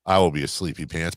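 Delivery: usual speaking voice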